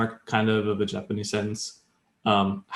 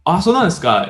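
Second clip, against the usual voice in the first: higher, louder Japanese question of surprise; interested surprise; 8 semitones higher, 6 dB louder than usual. Shown as a demonstration. Teacher demonstrating intonation that asks for more, interested surprise. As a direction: react keenly, wanting to hear more. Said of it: "it can mean based on the intonation".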